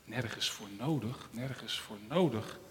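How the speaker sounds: soft, low, downcast murmur; sad little finger. Acted. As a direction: mumble it to yourself, hurt. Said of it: "een beetje verdrietig werd". (zacht en terneergeslagen gemompel)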